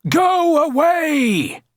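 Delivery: high, drawn-out storybook yell. A narrator's reading. Villagers driving off a giant, yelling. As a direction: yell it out like an angry crowd. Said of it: "yelled the people".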